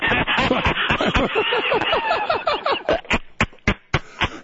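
long high laugh in pulses; real laughter. Real, not acted. Host teases guest about a mall opening, real high laughter. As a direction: crack yourself up.

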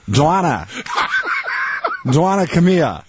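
high-pitched Boston accent, broad O's; hammed-up Boston parent calling out and telling off; Dorchester accent. Shown as a demonstration. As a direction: scold in thick Dorchester speech. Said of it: "including the O's".

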